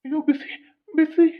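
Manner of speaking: high yelp, scalded; yelp at hot water. Acted. Husband yelps as wife pours hot water, high. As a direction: cry out as the hot water hits.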